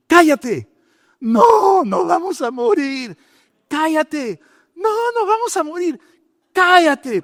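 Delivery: high, sharp shouts to silence him; mock yell. Preacher yelling at the terrified missionary to shut up, high sharp shouts. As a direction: snap him out of his panic.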